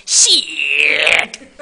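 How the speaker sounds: drawn-out Baltimore "Shit!" with a rising end; Baltimore accent. Retold or imitated. Caster imitating the guy from The Wire, Baltimore accent. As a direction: stretch the word out like a Baltimore street guy. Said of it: "the Baltimore accent".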